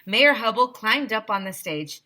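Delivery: ordinary delivery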